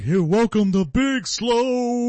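high, slow, loud taunting voice; mocking wrestler. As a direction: drag it out as a taunt. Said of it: "He's like".

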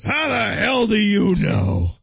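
loud, slow demand; shouting chief. Pretend. Chief grilling the detective, loud shout. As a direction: bark it in disbelief. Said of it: "He goes".

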